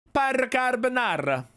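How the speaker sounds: put-on high, hammy Italian accent. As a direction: toss it off in a mock Italian accent. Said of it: "I refuse to do Italian accents".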